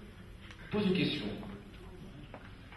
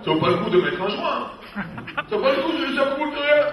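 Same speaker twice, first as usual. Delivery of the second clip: higher, put-on neighbor voice, laughing; voiced neighbor; 9 semitones higher, 5 dB louder than usual. Neighbor from the sixth floor brushing off the leak, mock casual. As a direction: play the careless upstairs neighbor shrugging it off.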